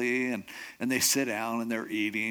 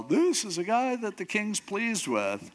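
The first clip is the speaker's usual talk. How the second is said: higher, drawn-out mock proclamation; reluctant yell; 8 semitones higher than usual. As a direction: call it out slowly, under protest. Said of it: "I'm having to yell".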